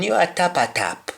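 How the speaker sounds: "tapa tap" said as one run, tah-pa-tap; Patois tapa tap. Shown as a demonstration. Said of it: "just like King Solomon".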